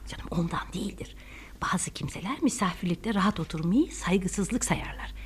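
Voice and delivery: hushed reassurance. Acted. Mother calms daughter about the stiff guest, hushed reassurance. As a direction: reassure her privately under your breath.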